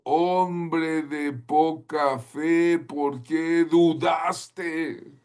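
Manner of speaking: slow tender rebuke; slow gentle rebuke. Acted. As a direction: gently scold a doubting friend. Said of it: "le dijo".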